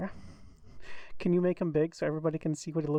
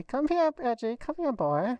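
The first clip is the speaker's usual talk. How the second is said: calling a pet; 7 semitones higher than usual. Acted.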